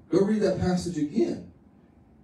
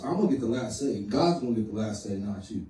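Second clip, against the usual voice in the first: fading to a hush; 8 semitones lower, 7 dB quieter than usual. Retold or imitated. Voiced prayer fading to a hush.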